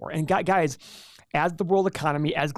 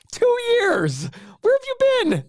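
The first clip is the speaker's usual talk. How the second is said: laughing, much higher squeaky voice; mock squeal; 5 dB louder, slower than usual. Host teasing his long-gone cohost in a laughing, much higher squeaky voice.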